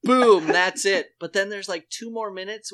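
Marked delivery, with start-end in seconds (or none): 0.0-1.1 loud, slow triumphant call. Retold or imitated. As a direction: slam it down with pride. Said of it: "And I was like".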